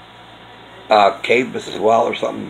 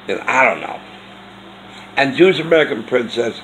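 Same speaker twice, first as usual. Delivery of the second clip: ordinary talk, nothing marked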